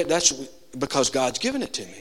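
normal talking voice

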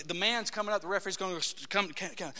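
ordinary delivery

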